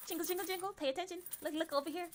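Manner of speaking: high, chirpy jingling chant; playful attention-getting. Pretend. Streamer jingling money to hold attention, high playful chant. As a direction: coax a distracted baby with jingling keys.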